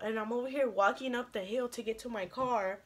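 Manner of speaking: ordinary delivery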